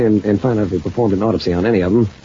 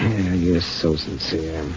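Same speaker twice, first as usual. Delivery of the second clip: sarcastic; 3 semitones lower, 6 dB quieter, much slower than usual. Real, not acted. Mocking her faked fear.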